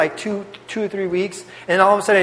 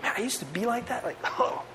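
much softer, almost breathed aside to himself; soft self-realization; 3 semitones higher, 14 dB quieter than usual. Retold or imitated. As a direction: hush it like a private thought. Private realization about himself.

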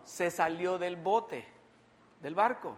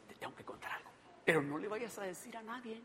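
hushed, confidential near-whisper; secretive gossip; 3 semitones higher, 11 dB quieter, faster than usual. Acted. Gossip passing on a secret, hushed. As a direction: confide it very softly. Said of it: "El chisme".

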